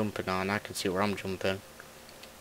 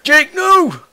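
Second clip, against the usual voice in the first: loud yell; 15 dB louder than usual. Real, not acted. Friend messes up his game.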